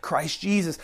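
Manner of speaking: normal talking voice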